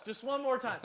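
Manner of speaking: usual speaking voice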